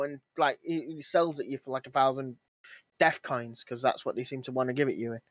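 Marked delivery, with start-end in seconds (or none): none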